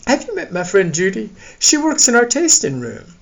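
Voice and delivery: high-pitched woman's character voice; high character voice, making introductions; lively hostess. Acted. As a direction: introduce a friend in a high, perky voice.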